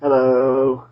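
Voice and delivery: loud, drawn-out guest hello; put-on radio voice. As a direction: greet listeners in a put-on radio voice. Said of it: "I'll put a voice on".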